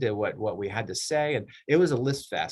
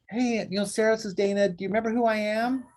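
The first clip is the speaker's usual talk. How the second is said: bright, much higher "remember me" voice; mock cheery client; 8 semitones higher than usual. A client who vanished for months calls her agent, mock cheery and high. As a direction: play the long-lost client calling out of the blue.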